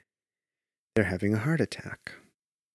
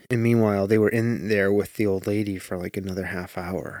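ordinary talk, nothing marked

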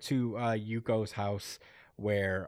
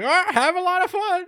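much higher, cheery neighbor voice; chirpy neighbor; 7 dB louder than usual. Retold or imitated. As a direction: greet her like a cheery old neighbor.

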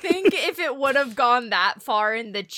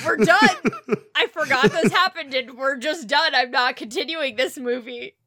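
raised, loud protest through laughter; laughing mock outrage; 3 semitones higher, 5 dB louder than usual. Viewer swearing off the movie over a cheesy line, laughing mock outrage. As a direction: declare you quit, half laughing.

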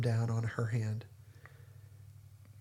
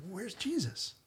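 higher, puzzled inner voice; mock exasperated search, pitched up; impatient wondering; 8 semitones higher than usual. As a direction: ask it like you're getting restless waiting. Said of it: "I think".

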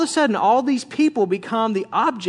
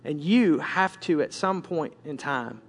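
low, soft and measured rebuke; hushed, grave authority; stern, still small voice; 6 semitones lower, 5 dB quieter than usual. Acted. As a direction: correct them quietly but firmly, with long pauses. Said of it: "very sternly just speak to my heart".

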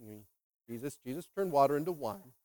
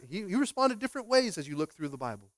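ordinary talk, nothing marked

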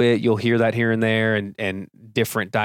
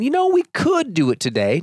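much higher, breezy and loose; casual maybe; 11 semitones higher than usual. Shown as a demonstration. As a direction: offer it as if it's optional. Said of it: "It's not saying".

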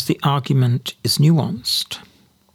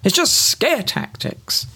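mock dismissive sceptic; 6 semitones higher than usual. Commentator mocks Brexiteers ignoring forecasts, sneering higher voice. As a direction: sneer it like a know-all waving away warnings. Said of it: "But you say".

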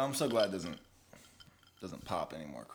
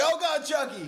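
high-pitched mock exclamation, louder; mock alarmed cry; 14 semitones higher, 4 dB louder than usual. Comic retelling to co-host, mock alarmed cry.

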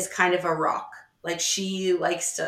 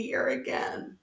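whisper; 8 dB quieter, slower than usual. Real, not acted. Trailing off softly.

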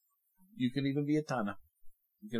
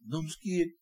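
higher, timid mock bird voice; a frightened baby bird refusing to jump; scared little bird; 8 semitones higher, 3 dB louder than usual. Preacher voicing a bird afraid to leave the nest, timid and higher.

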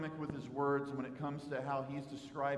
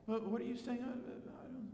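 higher, stammering, trailing off softly; feigned confusion; 7 semitones higher, 5 dB quieter than usual. Acted. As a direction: play confused and shuffle out of it. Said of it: "Just trying to act confused and shuffle off".

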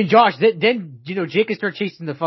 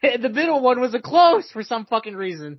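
high-pitched vision, building the reveal; mock fortune teller; 7 semitones higher, 3 dB louder than usual. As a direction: play a psychic baffled by the vision. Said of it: "Look into your fucking crystal ball".

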